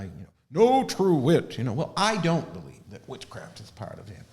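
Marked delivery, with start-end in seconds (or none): 0.5-1.6 slow and louder, a teasing jab; cheeky. Retold or imitated. Debate-club quip at her friend, cheeky and slow. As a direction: lean on it cheekily, higher. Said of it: "I was being sort of cheeky".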